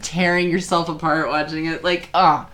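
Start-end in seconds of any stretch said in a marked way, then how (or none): none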